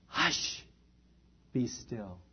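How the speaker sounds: soft whispered hush, then low and quiet; calm the storm with a hush; quiet soothing command. Retold or imitated. Jesus calms the storm.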